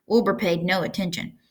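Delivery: usual speaking voice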